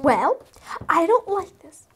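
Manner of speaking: ordinary talk, nothing marked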